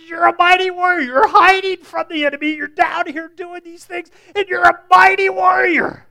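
mock him with big praise; sarcastic shout. The angel teasing Gideon, sarcastic and loud. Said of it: "so sarcastic".

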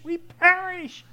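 "we perish" cried slow, high and loud; slow, high, loud terrified "we perish"; panicked cry. Retold or imitated. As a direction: wail "we perish" as if drowning. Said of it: "the disciples are all panicking".

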